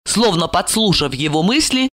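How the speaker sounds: ordinary delivery, nothing marked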